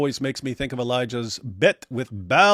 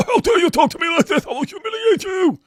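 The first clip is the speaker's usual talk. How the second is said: much higher, louder hammed-up indignation; squealing mock fury; mock outraged voice; 6 dB louder than usual. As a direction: play a pompous man taking offence. Mock voicing of offended Hijab.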